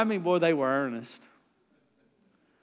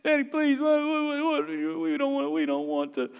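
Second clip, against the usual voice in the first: lightly voiced kids, higher and louder, begging; pleading little boys; 9 semitones higher, 3 dB louder than usual. Boys negotiating with dad, mock pleading. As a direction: plead fast like a desperate little boy. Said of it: "and they said".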